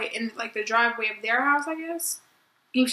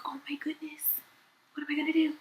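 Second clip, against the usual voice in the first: breathy half-whispered panic; panicked whisper; 3 semitones higher, 6 dB quieter than usual. Retold or imitated. Mock panicked whisper to herself after hitting the toy. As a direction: hush it like a guilty secret.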